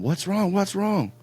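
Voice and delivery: mock-panicked, high-pitched wife; alarmed wife. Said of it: "My wife comes running from the bathroom".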